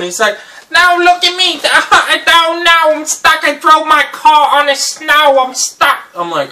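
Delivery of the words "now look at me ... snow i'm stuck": high, loud broken English whine; delivery man stuck in the snow, high and loud in a put-on accent; put-on accent of the driver.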